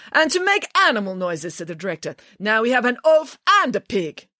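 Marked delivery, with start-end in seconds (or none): 0.1-1.5 high, indignant director voice; offended official's sneer; scornful. Acted. 2.4-4.3 high, indignant director voice; theatrical official's contempt; scornful. Acted. Director insulting the visitors.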